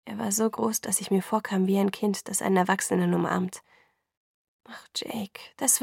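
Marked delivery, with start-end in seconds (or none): 4.6-5.8 whispering. Acted. Comforting Jacob in a hug.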